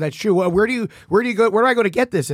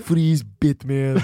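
put-on slow, low Austrian-accented catchphrase; Arnold Schwarzenegger; 5 semitones lower, much slower than usual. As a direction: drawl the catchphrase like an action star. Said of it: "You're Arnold's character".

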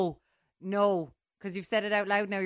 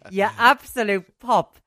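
much louder, slower mock outrage; playful furious bellow; outraged yell; 5 semitones higher, 16 dB louder, much slower than usual. Real, not acted. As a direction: yell it at your cheeky co-host.

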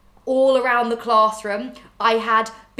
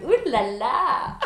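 mock French ooh la la; 4 semitones higher, much slower than usual. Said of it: "they'd all be like".

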